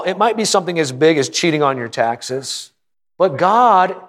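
ordinary delivery

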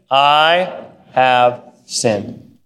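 very slow, low, word by word; deliberate confession. Shown as a demonstration. Preacher repeating David's words to the congregation, deliberate confession. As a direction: space out each word gravely. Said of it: "Let me say that. Here we go".

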